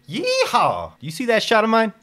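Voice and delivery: very high, loud triumphant whoop; whooping cowboy. Acted. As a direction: holler it out like a proud gunslinger. Lucky whoops after the shot.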